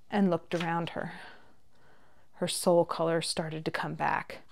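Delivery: ordinary delivery, nothing marked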